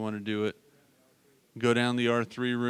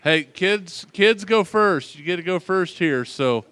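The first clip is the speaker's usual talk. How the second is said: loud call across a crowded room, higher; 6 semitones higher, 10 dB louder than usual. Real, not acted. Calling kids across the room.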